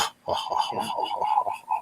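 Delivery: breathy wicked snicker; sly evil giggle; devilish laugh. Pretend.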